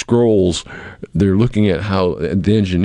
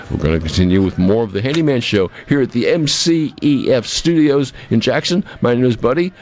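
raised-pitch show plug, building to the break; radio host announcer; 5 semitones higher than usual. Acted. Host sending listeners to the break, radio announcer style. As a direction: sell the show with a radio flourish.

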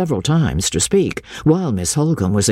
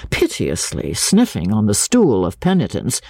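ordinary delivery, nothing marked

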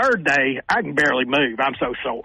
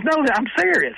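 retold insistence; 11 semitones higher than usual. Magazine caller, hung up on six times, retold as insistent. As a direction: insist it in a much higher quoted voice.

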